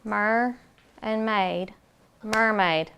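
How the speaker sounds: Mer, pause, maid, then blended Mermaid; split into syllables. Shown as a demonstration. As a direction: say Mer and maid apart, then join them. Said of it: "what does that slash mean".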